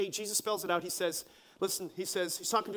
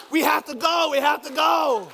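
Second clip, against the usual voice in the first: high, loud mock yell; exasperated dad; 5 semitones higher, 9 dB louder than usual. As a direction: yell over a bawling child, acted. Father over child's crying.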